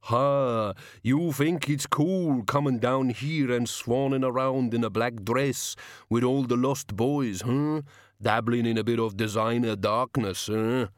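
a hint of a sneering bogeyman; lightly voiced, slow sneer.

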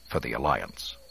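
usual speaking voice

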